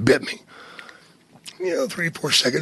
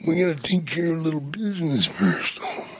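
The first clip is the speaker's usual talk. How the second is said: lightly imitated wrestler, quieter; Macho Man; 9 dB quieter than usual. Macho raising business with Jake, wrestler impression. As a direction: play a restrained wrestler talking business. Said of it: "You did a great Macho Man".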